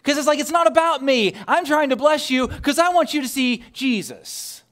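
high-pitched exasperated protest; frustrated. Retold or imitated. Parent protesting teachers' praise, frustrated. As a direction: plead your case in retold frustration. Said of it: "I got so frustrated then".

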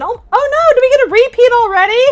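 oh no, laughing dismay; real dismay. Real, not acted. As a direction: caught off guard by a repeat. Dismay at a repeated toy.

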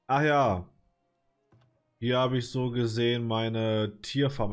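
usual speaking voice

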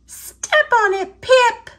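high, loud hurry-up call; urgent call. Acted. Squeak to Pip on the sled, urging speed with a high loud call.

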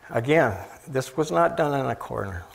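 ordinary talk, nothing marked

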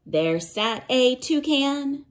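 plain reading voice

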